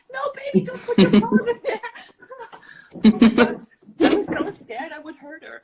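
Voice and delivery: high, loud laughing alarm; panicked laughing. Real, not acted. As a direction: laugh in a fright over the cat. Laughing alarm about cat paws.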